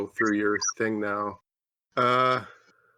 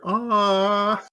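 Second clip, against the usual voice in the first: adorable noise; 10 semitones higher, 3 dB louder than usual. Retold or imitated. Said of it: "Adorableness no-, noises".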